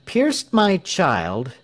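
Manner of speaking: slow, high-pitched defiant confession; slow and deliberate. A narrator's reading. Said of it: "said Tell slowly".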